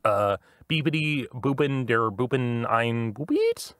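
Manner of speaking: put-on beeps and boops in a German accent; German-accented droid beeps. Player talks droid in German accent. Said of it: "Did you say boop with a German accent?".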